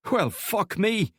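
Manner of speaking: gruff Scottish soldier, slow and high. A narrator's reading. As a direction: drag it out in a rough Scottish burr. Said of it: "a gruff Scottish voice remarked".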